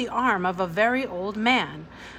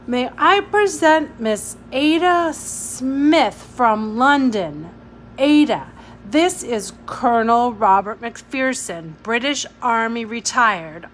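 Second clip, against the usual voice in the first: formal introduction; 4 semitones higher, 7 dB louder than usual. Acted. Stephen makes formal introduction.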